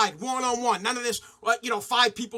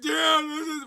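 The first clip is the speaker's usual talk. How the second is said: retold crying kid; 4 semitones higher, slower than usual. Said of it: "I'm crying like".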